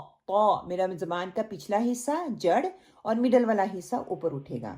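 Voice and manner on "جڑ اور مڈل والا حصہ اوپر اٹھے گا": ordinary talk, nothing marked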